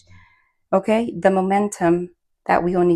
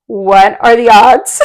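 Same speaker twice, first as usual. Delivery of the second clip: loud, drawn-out question breaking into laughter; laughing amazement; 4 semitones higher, 10 dB louder, much slower than usual. Real, not acted. Reader marvels to viewers at the card turning up, laughing. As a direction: stretch it out loudly, then crack up. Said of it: "you know why I'm laughing".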